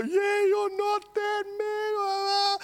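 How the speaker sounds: very high, drawn-out hammed-up whine; mock wail. Man doubting himself in the mirror, mock wailing. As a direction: wail it in exaggerated, comic despair.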